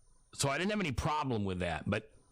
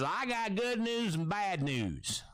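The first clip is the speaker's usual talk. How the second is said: higher, slow put-on Southern accent; 10 semitones higher, slower than usual. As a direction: imitate the Southern publisher breaking news slowly. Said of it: "he's got a Southern accent".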